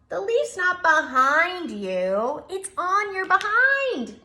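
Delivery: loud, slow, high teasing laugh-line; slow, loud sing-song giggle; laughing cry. Acted. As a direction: tease him with a loud giggly shout. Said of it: "they cried".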